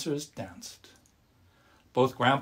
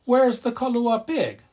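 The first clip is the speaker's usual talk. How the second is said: much higher, loud demand; grandma asking; 11 semitones higher, 7 dB louder than usual. A narrator's reading. Grandma asks grandpa where the pig is, high and loud. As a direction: ask in disbelief. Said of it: "Grandma asked".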